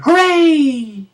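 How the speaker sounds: high, bright mock cheer. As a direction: cheer like a kids' show host. Storybook cheer.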